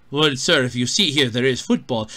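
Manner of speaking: a smooth condescending waiter explaining; mock waiter.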